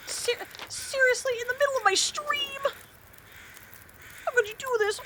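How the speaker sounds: high, squeaky mock indignation; mock outrage. Streamer pretending to be outraged at the interruption, high mock indignation.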